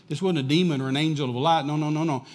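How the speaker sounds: normal talking voice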